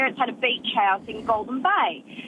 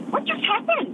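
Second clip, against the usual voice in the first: high-pitched, incredulous question; retold bewilderment; 11 semitones higher than usual. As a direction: voice leaps up in mock disbelief. Retold bewildered reaction after friends left.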